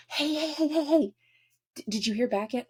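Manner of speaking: high, eager pestering hey hey hey; eager bestie. Retold or imitated. Work bestie pestering you for news, high and eager. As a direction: bounce in like a nosy coworker.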